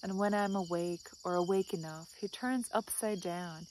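soft, measured recital falling away at line ends; poem reading. Acted. Vlogger reads the dog Percy's advice, soft poem recital. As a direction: recite it gently, pausing after each image.

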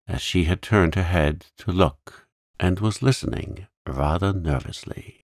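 plain reading voice

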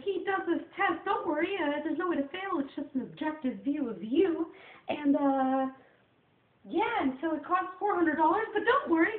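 very high, loud, put-on therapist voice; impersonated therapist. Therapist reassuring a client about a test's cost, high-pitched impersonation. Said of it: "I like impersonating people".

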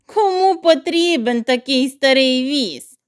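ordinary delivery, nothing marked

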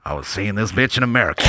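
deep, softened comic storytelling; Dave Chappelle impression. Stand-up opening a story, deep soft impression. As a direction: start the story low and confidential. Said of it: "trying to get the deepness in here".

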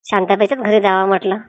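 ordinary talk

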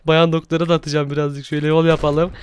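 ordinary delivery

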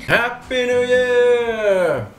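high, loud festive yell; excited shout. Real, not acted. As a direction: shout it out with joy. Shouted New Year greeting.

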